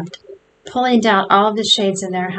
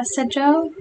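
ordinary delivery, nothing marked

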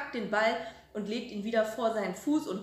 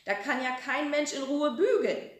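higher, louder scolding; angry yell; 6 semitones higher, 5 dB louder than usual. A narrator's reading. Grandma ironing shouts at noisy grandson, angry and loud. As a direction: snap at him to stop. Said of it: "schreit die Oma".

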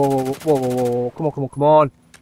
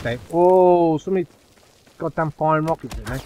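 ordinary talk

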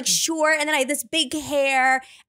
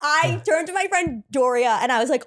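ordinary talk

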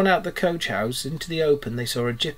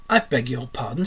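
plain reading voice

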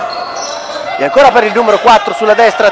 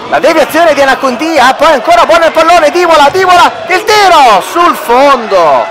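excited commentary; 6 semitones higher than usual. Real, not acted. Near chance, commentator rises.

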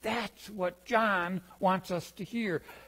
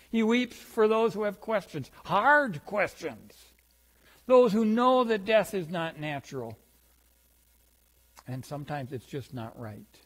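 normal talking voice

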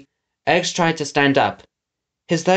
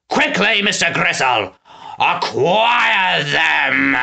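villain's shout, last words drawn out; bellowed order; 3 semitones higher, slower than usual. A narrator's reading. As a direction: bellow a long-stretched command. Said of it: "Snatcher bellowed".

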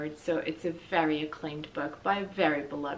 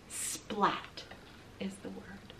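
hushed whisper; 9 dB quieter, much slower than usual. Acted. Reader sharing the comic's sound word with kids, whispered. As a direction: lean in and whisper it.

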